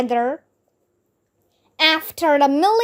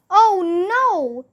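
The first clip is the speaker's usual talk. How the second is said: dismayed holler; 6 semitones higher than usual. A narrator's reading. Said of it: "we all hollered".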